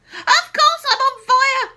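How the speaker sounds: high, loud outraged yell; shrill loud protest. A narrator's reading. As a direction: yell back at George. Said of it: "she yelled".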